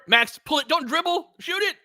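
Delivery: usual speaking voice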